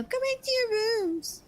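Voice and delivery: high, childish mimicry; mocking kid. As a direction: parrot the mother like a bratty child. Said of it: "They go".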